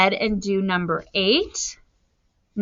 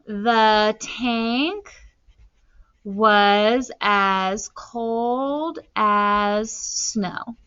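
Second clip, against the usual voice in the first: slow, spaced dictation; much slower than usual. Shown as a demonstration. Teacher writing a simile for the tank, slow dictation. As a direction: stretch the words as you write.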